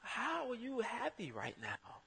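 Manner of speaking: high-pitched wonder at someone's calm; amazed disbelief. Retold or imitated. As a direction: marvel at a friend's happiness. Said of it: "Who I'm like".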